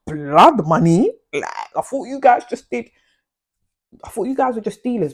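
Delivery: loud, incredulous repeat of blood money; disbelieving. Retold or imitated. Narrator shocked at the stranger's words, loud disbelief. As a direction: throw the question back in disbelief. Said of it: "So now I'm like".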